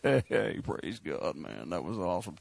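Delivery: mock deep churchgoer. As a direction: drop way down low and hush it out like a dazed worshipper. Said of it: "you're like".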